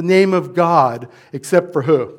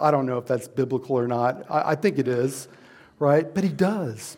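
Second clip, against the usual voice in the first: ordinary delivery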